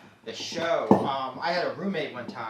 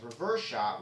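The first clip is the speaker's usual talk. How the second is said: normal talking voice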